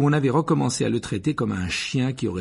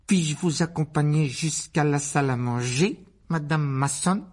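higher, put-on polite boy; Dudley rehearsing gallantry; 5 semitones higher than usual. A narrator's reading. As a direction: recite the invitation with practised courtesy. (garçon qui récite une galanterie maniérée)

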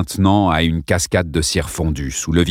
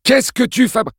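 urgent shout. Acted. Kevin cut off mid-question.

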